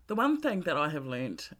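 ordinary talk

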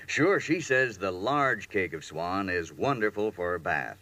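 ordinary talk, nothing marked

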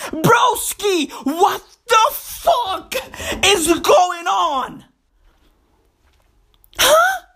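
incredulous rant with high-pitched "huh"s; exasperated, drawn-out comic outrage; outraged disbelief. Acted. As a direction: demand an explanation, stretching out the words. Ranting in disbelief at neighbor.

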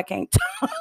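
self-mocking, dissolving into laughter; real laugh cutting through the words; laughing at oneself. Real, not acted. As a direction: break into a laugh mid-sentence. Laughs at own mispronouncing.

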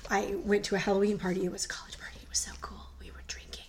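soft secret whisper. Real, not acted. Whispers about a college party.

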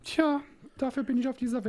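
high, fast, mock-proud quip; mock grand. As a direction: ham up a grand destiny. Joking boast to friend.

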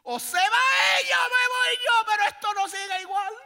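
screamed ultimatum, high and loud; furious shout. Acted. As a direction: shout it like a jealous wife at the end of her rope. Sarah's ultimatum acted out.

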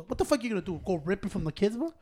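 ordinary talk, nothing marked

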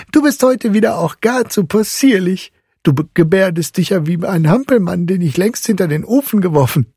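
put-on high, lively boy's voice; amused boy teasing. A narrator's reading. Fritz mocks his godfather's puppet antics, high and amused. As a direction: tease merrily in a high young voice. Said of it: "mit lautem Gelächter unterbrochen hätte".

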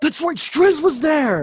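real excitement, loud and high; excited recognition. Real, not acted. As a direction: burst out with a sudden memory. Suddenly recalls who was there.

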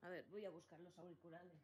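barely audible, far from the mic; very faint audio. Real, not acted. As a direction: voice coming through very faintly. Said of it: "arregle los problemas técnicos".

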